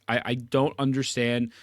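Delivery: ordinary delivery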